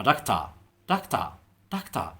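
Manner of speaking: doctor in a British accent, ending dokta with no r. Shown as a demonstration. Teacher shows learners British doctor. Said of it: "تبع البريتش برضه هينطقها ازاي؟".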